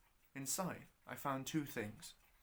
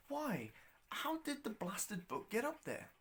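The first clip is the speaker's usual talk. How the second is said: higher, puzzled wondering to himself; baffled inner question; 8 semitones higher, 3 dB quieter than usual. Acted. Baffled traveller wondering how the book got there, puzzled. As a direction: ask it as if truly stumped.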